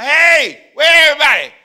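loud high call through the house. Acted. Joseph coming home to an empty house, loud call.

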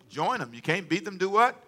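ordinary talk

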